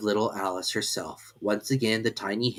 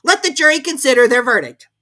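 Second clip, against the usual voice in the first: high, loud weary royal command; a king repeating his order yet again; 12 dB louder than usual. A narrator's reading. The King to the jury, high and commanding. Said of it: "the king said for about the twentieth time".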